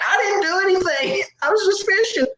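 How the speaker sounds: very high squeaky denial; mock innocent protest. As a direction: protest your innocence in comic falsetto. Mock innocence to teasing witnesses.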